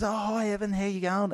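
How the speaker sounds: high chirpy mock greeting; a bright, high impression of her saying hello; imitating the organiser. Organiser greeting the comedian, high mock-cheerful. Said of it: "she goes".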